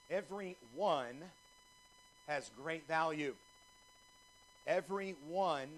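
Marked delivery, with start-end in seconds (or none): 0.1-1.2 everyone said slow and weighted; stressed everyone. Shown as a demonstration. Preacher stressing everyone to the congregation. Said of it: "emphasis on the word one". 4.7-5.7 Every one, with the weight on one; stress on one. Shown as a demonstration. Preacher teaching the church to stress one. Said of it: "emphasis on the word one".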